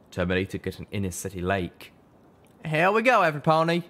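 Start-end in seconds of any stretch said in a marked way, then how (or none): 2.7-3.8 high, loud call to the group; cheerful high shout. A narrator's reading. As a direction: call out to everyone over the wind. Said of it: "Calamity called out".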